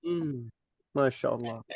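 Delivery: usual speaking voice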